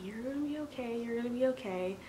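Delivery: put-on soft, lighter reassurance to a hurt baby; soft comforting words to a crying child; soothing. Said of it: "soothing Marcus".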